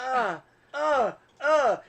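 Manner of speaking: high, loud straining grunts; strained grunts. Retold or imitated. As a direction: grunt up high as if pulling hard.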